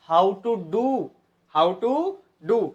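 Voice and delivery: how to do stretched out very slowly; slow repeat. Shown as a demonstration. Teacher slowly repeats the phrase for students. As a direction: drag each word for the class. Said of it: "slow demo repeat".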